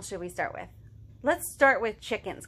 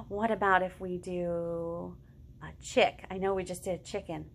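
ordinary talk, nothing marked

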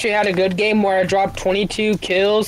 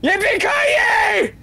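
slow battle cry; 11 semitones higher, much slower than usual. Acted. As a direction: stretch it out like a cowboy charge. Charging in with minigun.

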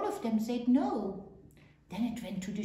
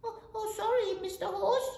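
high, stammered apology; apologetic little kid goat; 12 semitones higher, 5 dB louder than usual. A narrator's reading. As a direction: back off as a small goat.

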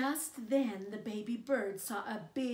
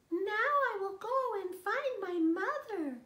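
much higher little chick voice; a tiny lost chick calling out; baby bird; 11 semitones higher, 7 dB louder than usual. Acted. Newly hatched baby bird looking for its mother, high chick voice. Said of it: "he said".

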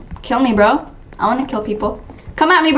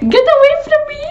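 very high mock protest; high, giggly get-away cry; playful squeal; 14 semitones higher, faster than usual. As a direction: squeal it as you flee. Playful flight from players.

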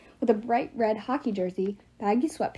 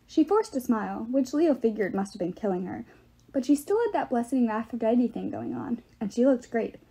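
usual speaking voice

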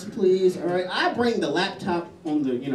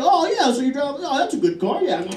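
higher, chewing-mouth small talk; mock dinner date chatter; 8 semitones higher than usual. Date chatting with food in mouth, higher mock chatter. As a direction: fake chatter over food.

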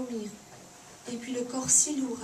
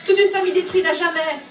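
loud, raging father, higher and louder; furious rant; 7 semitones higher, 9 dB louder than usual. Acted. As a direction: spit out the hatred of an outraged father.

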